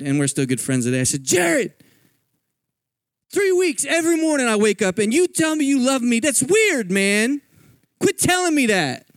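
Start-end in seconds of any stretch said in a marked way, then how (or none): 1.2-9.1 much higher, pushed-up complaining rant; a fed-up rehab patient unloading on his counselor, high and exasperated; exasperated outburst. Retold or imitated. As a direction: let three weeks of annoyance burst out. Blowing up at the counselor Jared.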